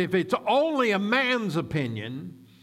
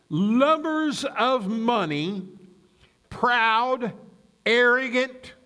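slow, raised, emphatic reading with long pauses; slow scripture reading; 3 semitones higher, slower than usual. Real, not acted. Pastor reading warnings about the last days, slow and weighty. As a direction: spell out each item deliberately, letting it sink in. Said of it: "we're going to read it slowly".

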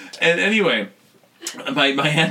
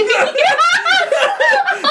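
high laughing fit; 6 dB louder than usual. Real, not acted. Laughing at the story.

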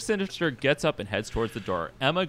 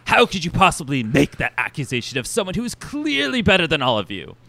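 loud, higher, affronted grandeur; indignant diva boss; 4 semitones higher, 5 dB louder than usual. Acted. Vain boss answering a hangover accusation, haughty indignation. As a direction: act deeply offended and superior. Plays vain Mister Sinister.

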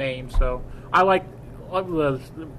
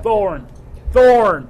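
louder and higher each time; insistent repeat; 6 semitones higher, 8 dB louder than usual. Real, not acted. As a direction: keep insisting, getting louder.

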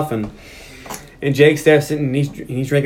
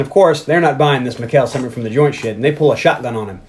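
ordinary talk, nothing marked

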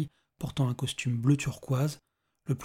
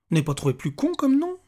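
high, louder incredulous question; pitched-up scornful reply; mocking retort; 12 semitones higher, 5 dB louder than usual. A narrator's reading. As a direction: fire back in disbelief. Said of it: "répliqua Johan".

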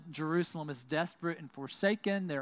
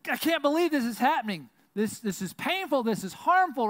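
higher, louder, pained protest; anguished lament; 8 semitones higher, 5 dB louder than usual. Acted. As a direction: pour out the pain in a rush. Voicing a lament of grief.

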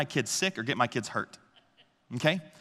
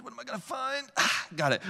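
put-on curious toddler; 9 semitones higher, 4 dB quieter than usual. Toddler voiced reaching counter.

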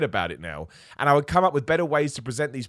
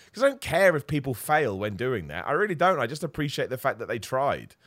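ordinary talk, nothing marked

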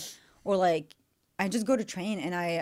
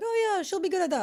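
high bright mock mom, much higher; mom's voice; 13 semitones higher, 3 dB louder than usual. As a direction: imitate mom chirping approval. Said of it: "my mom was like".

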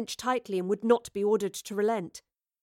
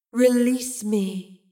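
louder, very drawn-out eerie command; insistent otherworldly voice; 5 dB louder, much slower than usual. A narrator's reading. Mysterious voice insisting to Astrid, loud and eerie. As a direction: call out slowly and forcefully, disembodied.

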